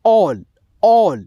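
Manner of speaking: all said with an aw sound. Shown as a demonstration. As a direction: round the a in all to aw. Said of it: "ओ में आएगा".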